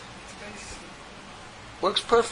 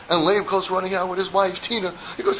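ordinary delivery

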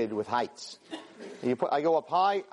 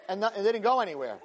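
normal talking voice

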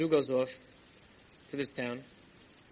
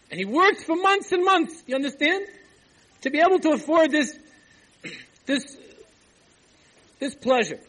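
high, loud storytelling fervor; impassioned preaching; 7 semitones higher, 8 dB louder than usual. Real, not acted. Storyteller stresses the weeks of toil for a craving, impassioned. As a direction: preach it at full force, voice raised high. Preacher builds the moral tale.

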